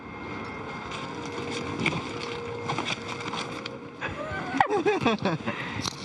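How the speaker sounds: long delighted laughter; gleeful laugh. Real, not acted. Laughing after the jump.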